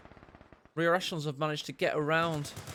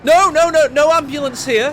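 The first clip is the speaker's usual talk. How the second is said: much higher, loud frantic "no, no, no"; panicky denial; 8 semitones higher, 15 dB louder than usual. Pretend. Medics insisting to the tank there's no ambulance, mock panic. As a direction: deny everything in a squeaky rush.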